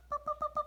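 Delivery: high playful boops; high boop sounds. Pretend. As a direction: boop like a toy. Sound effect for child.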